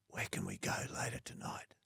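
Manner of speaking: soft breathy whisper, no voice at all. Retold or imitated. Pamela Anderson leaning in to whisper to Dermot, unvoiced whisper. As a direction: lean in and whisper it close to his ear. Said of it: "hearing her whisper".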